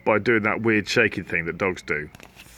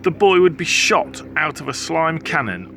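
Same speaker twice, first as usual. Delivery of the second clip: plain reading voice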